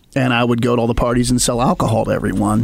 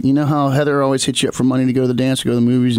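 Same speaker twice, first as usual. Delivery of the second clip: ordinary talk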